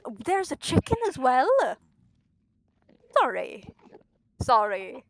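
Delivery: very loud panicked yelling; loud shout. Real, not acted. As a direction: scream it at the mobs. Yelling at game mobs.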